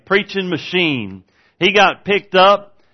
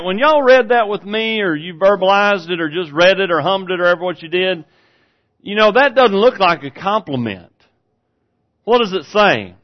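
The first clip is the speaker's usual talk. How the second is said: ordinary talk, nothing marked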